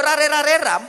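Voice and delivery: high, quick spoken syllables, loud; high-pitched rattle, dropping at the end; mock translation.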